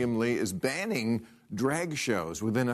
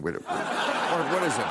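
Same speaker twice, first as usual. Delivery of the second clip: put-on valley girl voice; 3 semitones higher than usual. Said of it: "They're talking like a valley girl?".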